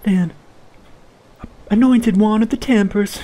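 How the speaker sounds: high, formal herald voice; high announcer voice. Acted. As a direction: announce the rite grandly. Voices a high-pitched figure.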